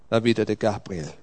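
ordinary delivery

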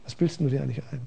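soft sneering accuser, half-laughing at the end; quiet devil voice. Retold or imitated. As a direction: murmur doubts like the tempter. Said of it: "der kommt leise her".

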